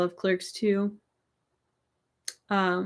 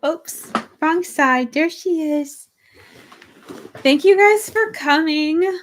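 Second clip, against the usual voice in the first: cheery; 7 semitones higher, 5 dB louder, slower than usual. Real, not acted. Signing off cheerily to viewers.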